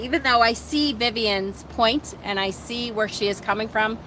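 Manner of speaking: usual speaking voice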